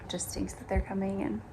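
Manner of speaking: ordinary delivery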